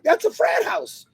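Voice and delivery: high, amused call-out of the frat house; excited exclaiming. Retold or imitated. Driving past the college, pointing out a frat house, high and excited. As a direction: point it out with a gleeful lift. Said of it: "And I'm like".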